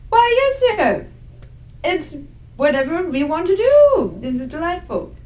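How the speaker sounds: high, swooping genteel voice; lilting, fussy approval; delighted Agnes. Acted. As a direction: humour a child with dainty delight. Agnes humours the child.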